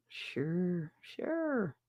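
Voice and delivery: very soft, drawn-out agreement; falsely sweet little assent; insincere forgiveness. Retold or imitated. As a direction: coo it softly, not meaning it. Retold grudging forgiveness after fight.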